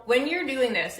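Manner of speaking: normal talking voice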